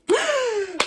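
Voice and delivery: gasp. Retold or imitated. Girl leans in, foreheads touch.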